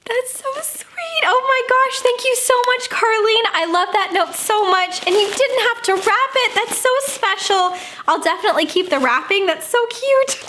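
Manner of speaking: high, excited thanks; touched and gushing. Real, not acted. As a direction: gush over a sweet note. Touched by fan's note.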